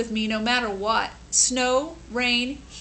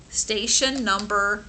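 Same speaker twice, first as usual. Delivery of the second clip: plain reading voice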